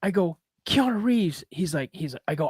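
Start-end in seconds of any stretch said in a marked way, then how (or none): 0.7-1.4 high-pitched, awed name; starstruck recognition. Retold or imitated. Retelling bumping into Keanu Reeves, starstruck. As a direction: say the name in acted disbelief.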